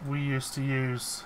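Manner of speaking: buzzy distorted audio; bee voice. Real, not acted. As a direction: sounds like a buzzing bee. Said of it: "You sound like a, a bee".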